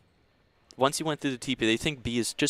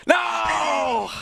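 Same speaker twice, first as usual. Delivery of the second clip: shouted no. Real, not acted. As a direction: yell no in dismay. Reacts to lost round.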